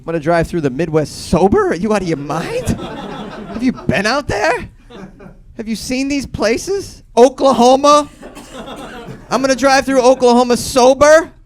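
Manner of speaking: loud, high-pitched disbelief; incredulous outrage. Acted. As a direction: fire off questions as if the idea is insane. Mock outrage at driving sober.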